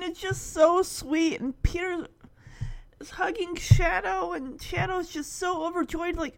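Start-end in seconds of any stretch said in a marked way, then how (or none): none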